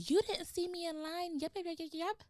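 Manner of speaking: high-pitched mock of a pushy lady; mocking the angry lady. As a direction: lightly imitate an irritated woman cutting in line. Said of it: "charges and was like".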